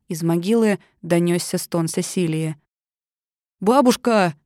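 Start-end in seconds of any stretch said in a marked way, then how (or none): 3.6-4.3 shout. A narrator's reading. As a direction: call out to grandma. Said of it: "закричал Генри".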